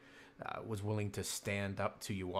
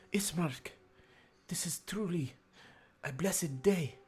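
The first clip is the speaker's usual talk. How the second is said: higher, smiling sister full of joy; smiling, joyful; 7 semitones higher than usual. Acted. Sister tells her brother the good news, higher and smiling. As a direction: lift it with relief and gladness. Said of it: "She smiles at her brother".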